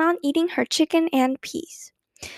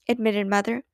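ordinary delivery, nothing marked

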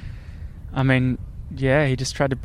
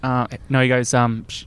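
ordinary talk, nothing marked

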